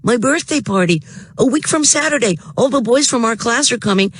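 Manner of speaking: put-on high, fast, eager boy's voice; bubbling over with news; excited boy. A narrator's reading. Frank's voice.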